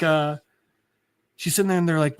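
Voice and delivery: usual speaking voice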